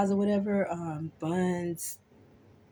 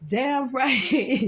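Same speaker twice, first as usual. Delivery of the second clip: defiant retort; 6 semitones higher, 5 dB louder than usual. Retold or imitated. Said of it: "And I was like".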